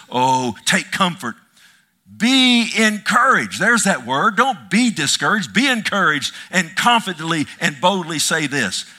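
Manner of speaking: loud, emphatic sermon reading, slowed on key phrases; preaching at full force. Acted. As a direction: pound every line of the promise home. Preacher exhorts the congregation.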